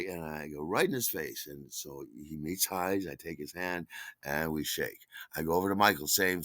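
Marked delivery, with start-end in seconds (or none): none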